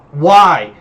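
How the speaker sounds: loud, drawn-out wail of protest; loud cry. Acted. Flailing vampire demands a reason from the hunter, crying out. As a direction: cry it out at full volume.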